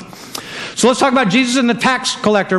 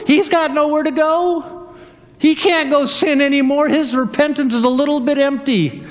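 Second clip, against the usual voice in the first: ordinary delivery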